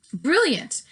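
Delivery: high, loud burst of delight; grandmother crying out. A narrator's reading. Grandmother praising the mouse boy's clever plan, excited cry. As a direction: exclaim it like a thrilled grandmother. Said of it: "my grandmother cried out".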